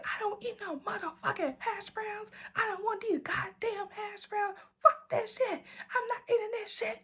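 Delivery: a tearful squeaky tantrum; drunk crying whine. Retold or imitated. Refusing hash browns without ketchup, high weepy drunk whine. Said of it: "And I'm just crying".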